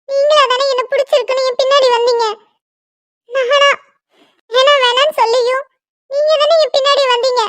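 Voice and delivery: high, tearful begging voice; tearful pleading. Acted. Jilted woman begs the groom who abandoned her, tearful and high. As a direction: plead with him through rising tears.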